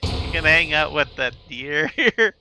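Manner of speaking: laughing through the words. Real, not acted. Laughing while leaving kid behind.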